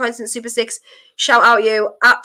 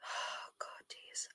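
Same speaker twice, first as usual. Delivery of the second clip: whisper; 29 dB quieter, much slower than usual. Real, not acted. Relief after tense match end.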